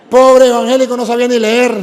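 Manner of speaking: mock pity. Real, not acted. Mocking the evangelical's misreading.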